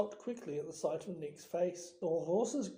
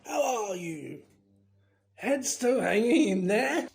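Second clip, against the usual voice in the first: booming, jovial ghost huntsman; loud, teasing bluster; roaring heartiness; 4 semitones higher, 8 dB louder than usual. A narrator's reading. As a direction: hail an old acquaintance boisterously. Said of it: "he roared".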